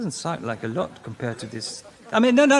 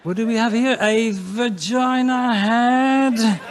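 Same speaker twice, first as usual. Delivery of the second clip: archaeologist voice; 7 semitones higher, 7 dB louder, slower than usual. Acted. Future archaeologist with a brush.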